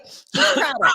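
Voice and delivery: high, lightly mocking proud voice. Mimicking the dancer proudly, high mock voice. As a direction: repeat it like he would, mockingly. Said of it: "And he's like".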